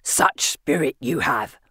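low, slow villain voice; Asriel's taunt. A narrator's reading. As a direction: draw it out in a low, mocking voice. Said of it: "Asriel yells".

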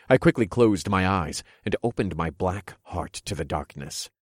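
ordinary delivery, nothing marked

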